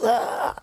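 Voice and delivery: high sharp cry; high demon cry. Retold or imitated. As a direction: yelp as if burned. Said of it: "He goes".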